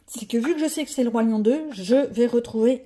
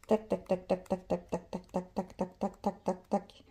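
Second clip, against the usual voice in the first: soft, evenly spaced tac sounds; rapid tac-tac ticking; 4 semitones lower, 11 dB quieter than usual. Real, not acted. Busy with her pages, softly ticking tac, tac. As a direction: tick quietly along, low and even. Counting through pages while working.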